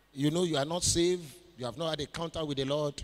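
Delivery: ordinary talk, nothing marked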